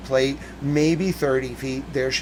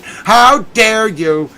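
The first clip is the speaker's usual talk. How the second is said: slow, high, louder outrage; indignant; 7 semitones higher, 7 dB louder, much slower than usual. Real, not acted. Fan scolding local countrymen, indignant. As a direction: spit it out slowly in disbelief. Rant at fellow fans.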